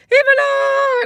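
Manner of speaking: very high, loud call of a name; father calling out. Retold or imitated. Father spotting his daughter, calling her loudly. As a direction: holler "Ivana!" across a playground. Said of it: "my father comes around the corner and is like".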